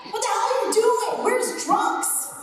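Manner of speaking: high, loud throat-placed anime woman's yell; Bulma. Shown as a demonstration. Character yelling at Vegeta about Trunks, high loud scolding. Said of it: "this is where Bulma lives".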